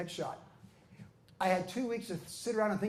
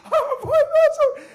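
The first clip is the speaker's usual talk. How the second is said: very high laughing yell, drawn out; laughing victory shout; ecstatic shout; 14 dB louder than usual. Retold or imitated. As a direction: scream it in disbelief and joy. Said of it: "I was like".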